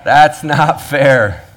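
drawn-out indignant protest; mock complaint. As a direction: whine it out like a cheated worker. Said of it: "to which we all say".

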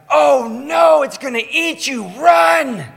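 very high, loud panicked shout; mock panicked parent. Parent answers child's monster fear, very high panicked yell. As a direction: ham up a terrified parent.

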